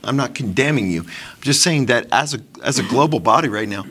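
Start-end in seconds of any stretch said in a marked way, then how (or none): none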